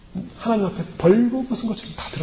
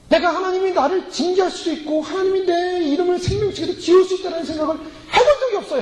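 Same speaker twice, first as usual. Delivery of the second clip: confession prayer; 8 semitones higher than usual. Acted. Acted confession prayer to God.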